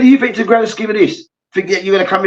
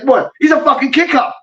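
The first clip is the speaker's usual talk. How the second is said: higher, shouted disbelief; mock incredulous shout; 7 semitones higher than usual. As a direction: shout it as a stunned teammate. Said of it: "the man was like".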